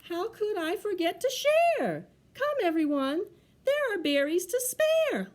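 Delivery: high, exclaimed bear call; eager invitation. Acted. Bear invites everyone to the berries, high and eager. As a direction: call everybody over generously.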